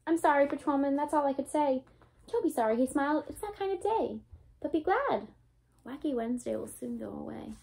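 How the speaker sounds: lively storybook read-aloud, bouncy and bright; reading to children. A narrator's reading. Teacher reading a Dr. Seuss book to her class, lively read-aloud. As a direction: read it like story time for little ones.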